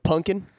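"Punkin" for pumpkin, with an N; Southern pronunciation. Retold or imitated. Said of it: "there's other words too".

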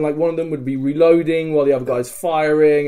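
ordinary delivery

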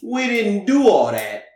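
put-on Italian accent. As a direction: play it like a Jersey Italian. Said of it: "A fake Italian and a real Italian".

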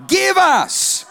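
high, loud, bold demand; bold shout. Acted. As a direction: shout it boldly.

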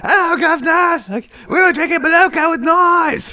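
very high, loud cartoon British accent. Mimicking [name]'s British accent for co-host, high mock British. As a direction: squawk it in a hammed-up British accent. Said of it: "she's doing the British accent".

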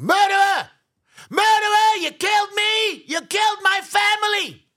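high, very loud accusing scream; full-force scream. Retold or imitated. Survivor accusing the man at the altar, anguished scream. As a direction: scream the accusation with everything you have. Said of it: "Mister Friedman screamed".

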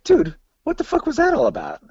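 high-pitched retelling of disbelief; mock bewildered demand; retold incredulous question. Retelling his baffled question to Tim.

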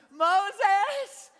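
high, loud hammed-up wailing sobs; mock ugly crying. Israelites wailing at Moses, hammed-up ugly crying. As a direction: whine and sob to Moses like a kid. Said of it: "the ugly cry".

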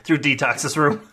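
ordinary talk, nothing marked

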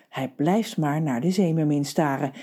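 usual speaking voice

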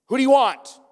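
loud, high call to the crowd; Pilate calling out. Retold or imitated. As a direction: throw the question out to the mob.

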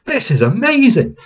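high, loud burst of amazement; thrilled exclamation. Retold or imitated. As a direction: gasp it out like a kid in awe. Retelling thrill of piloting mech.

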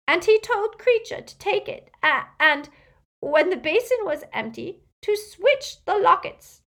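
high, loud elf voice breaking into sobs; sobbing house-elf. A narrator's reading. Elf relays his master's orders about the lockets, sobbing. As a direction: stammer it out through tears. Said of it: "Creature's sobs came in great rasps now".

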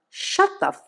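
hammed-up yell to silence him; scream. Maynard silencing the prisoner, mild mock scream. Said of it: "Maynard screamed".

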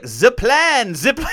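mock French accent, punched out. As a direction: put on an exaggerated French accent. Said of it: "she was channeling a French accent".

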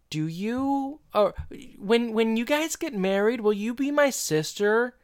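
put-on high-pitched, sweet child's question; little girl. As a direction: ask it like a hopeful little girl. Voicing little Morgan asking Amy.

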